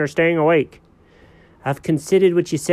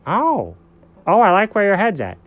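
high, triumphant cat; smug cat voice; 8 semitones higher than usual. Acted. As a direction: gloat as the know-it-all cat. Cat character in skit.

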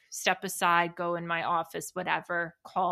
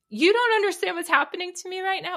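triggered outburst; 11 semitones higher, 5 dB louder, faster than usual. Retold or imitated. Retelling her own triggered outburst.

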